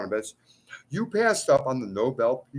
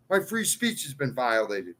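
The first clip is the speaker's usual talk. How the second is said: ordinary talk, nothing marked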